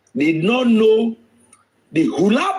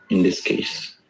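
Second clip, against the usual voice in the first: dropped to a whisper, low and soft; 12 semitones lower, 4 dB quieter than usual. Real, not acted. Host asking viewers who is the criminal, whispered. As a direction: hiss the end of the question to the audience. Rhetorical question to the audience.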